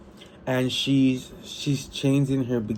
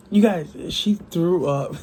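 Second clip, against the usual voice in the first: excited; 5 semitones higher, 4 dB louder than usual. Real, not acted. Excited reaction to book.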